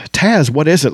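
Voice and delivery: high, urgent question; alarmed. A narrator's reading. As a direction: ask in sudden alarm.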